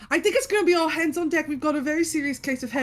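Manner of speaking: high, quick pretend emergency; urgent child assistant. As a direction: rush out the news like a kid playing hospital. Child rallies staff in game.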